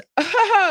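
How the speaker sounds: high, loud hooting laugh; real whooping burst of laughter. Real, not acted. Laughs at childhood nickname.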